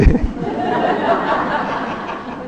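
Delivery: riso sem voz, soprado; risada soprada. Real, not acted. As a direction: ri baixinho, só de ar. Ri da história. (risada soprada e contida)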